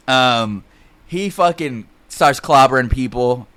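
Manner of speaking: usual speaking voice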